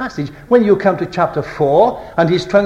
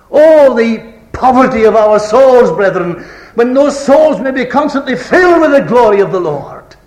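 fervent preaching; 7 semitones higher, 6 dB louder than usual. Real, not acted. Preacher lamenting poverty of souls to brethren, fervent. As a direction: cry out to the congregation in full voice.

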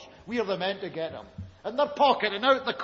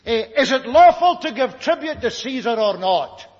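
loud trap question; 5 semitones higher, 9 dB louder than usual. Acted. Pharisees rehearse their trap question.

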